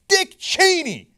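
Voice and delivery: high, loud, slow ironic drawl on the name; mock-dramatic unveiling; sarcastic reveal. Real, not acted. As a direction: savour the name for effect.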